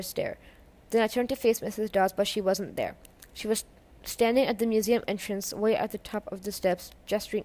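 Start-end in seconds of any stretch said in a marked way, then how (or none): none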